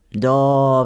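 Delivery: low, heavy "yes" with a sigh; weighty sighed acknowledgement. A narrator's reading. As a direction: sigh it out as a grave old miller. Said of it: "вздохнул Панкрат".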